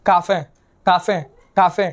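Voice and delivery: काफे said slowly as kaa-fe, ending in e; German pronunciation demo. Shown as a demonstration. Teacher drilling काफे for students, slow and careful. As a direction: repeat काफे slowly with a clear final e. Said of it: "word क्या बन गया, काफे".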